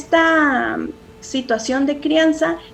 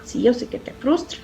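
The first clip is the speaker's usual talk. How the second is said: put-on softer, soothing words to a child; calmly name the feeling for him; gentle validating; 2 semitones lower, 7 dB quieter than usual. Shown as a demonstration. Mother calmly validating her son's frustration, softer.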